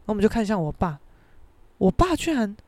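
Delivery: usual speaking voice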